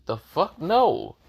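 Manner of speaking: high-pitched, indignant rejection; indignant refusal. A narrator's reading. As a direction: refuse it outright, pitch jumping up. Narrowed eyes, rejecting a suggestion.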